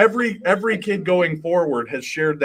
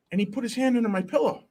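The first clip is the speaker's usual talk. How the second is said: higher, lightly voiced small son; little boy; 8 semitones higher than usual. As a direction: retell it as an earnest little kid. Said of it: "he's very little".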